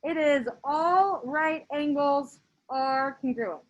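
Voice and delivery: very slow, every word spaced; slow emphatic reading. Shown as a demonstration. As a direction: drag it out as if obvious. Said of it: "a kind of an oh duh sentence".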